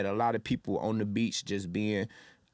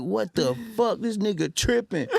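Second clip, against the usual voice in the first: 1 shocked disbelief; 10 semitones higher than usual. Retold or imitated. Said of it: "And I'm like".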